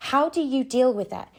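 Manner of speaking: ordinary delivery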